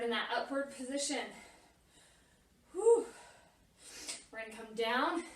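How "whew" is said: high sharp exhale of effort; loud winded whew. Real, not acted. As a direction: blow out a big breath. Exhales loudly during workout.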